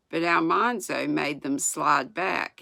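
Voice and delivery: ordinary delivery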